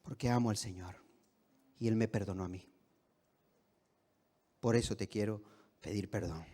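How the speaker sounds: low, soft and sincere; hushed, tender acted forgiveness; calm forgiving reply. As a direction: answer an outcry gently, voice dropped right down.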